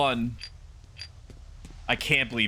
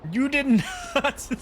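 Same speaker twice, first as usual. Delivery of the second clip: outraged shriek; slower than usual. Real, not acted. Streamer squealing at the game that reset him, higher. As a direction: squeal it in utter disbelief.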